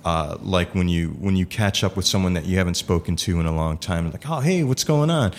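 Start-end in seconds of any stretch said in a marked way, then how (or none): 4.3-5.3 bright high-pitched hello, mock cheerful; cheery greeting. Imitating someone greeting an old friend, pitched up and cheery. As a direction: toss off a breezy, upbeat greeting.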